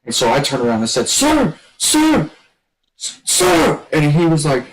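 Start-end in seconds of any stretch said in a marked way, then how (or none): 1.1-3.8 high urgent calls; urgent calling out. Retold or imitated. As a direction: call sir to get his attention. Calling the drill instructor urgently.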